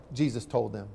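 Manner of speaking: normal talking voice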